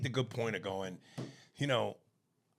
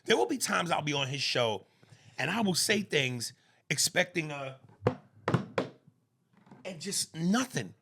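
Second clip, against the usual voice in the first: ordinary delivery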